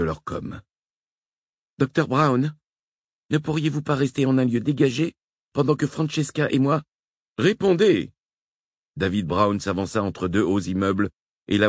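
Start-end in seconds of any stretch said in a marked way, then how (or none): 1.8-8.1 amiral à la radio. A narrator's reading. Heyman calls over radio.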